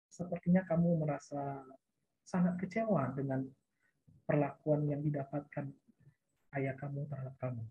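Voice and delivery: low, soft reflective counselor tone, sinking at the end; quiet, low and measured, descending to the close; falling intonation. Shown as a demonstration. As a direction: reflect the feeling back gently, letting the pitch drop. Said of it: "Agak, agak menurun tuh nadanya tuh".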